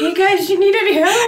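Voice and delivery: high, slow friendly offer; friendly Canadian. Pretend. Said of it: "more Canadian".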